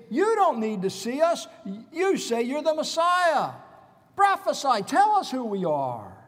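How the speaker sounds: high, loud taunting challenge; retold mocking. Mockers to the blindfolded prisoner, loud acted taunting. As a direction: imitate a jeering crowd goading its victim. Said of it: "They were mocking him".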